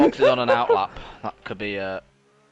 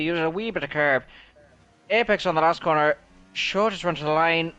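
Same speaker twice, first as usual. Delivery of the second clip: commentator read; 6 semitones higher than usual. Acted. Takes over as commentator.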